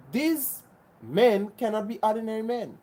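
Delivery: usual speaking voice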